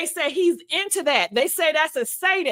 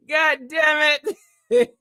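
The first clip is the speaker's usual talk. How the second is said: breathless laughing through the words; real laughing fit; laughing hard. Real, not acted. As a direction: crack up as you say it. Laughing fit after truck bit.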